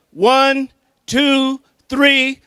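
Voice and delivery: shouted, high countdown; loud countdown. Real, not acted. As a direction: count it out to fire up the crowd. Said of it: "we gotta get excited".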